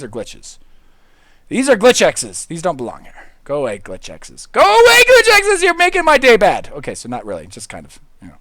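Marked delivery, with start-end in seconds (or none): 4.5-5.6 much higher and louder; playful shout of Go away glitch X's; mock shout. Loud second take. 5.6-6.7 much higher and louder playful shout; playful angry shout; mock yell. Shouting at glitches.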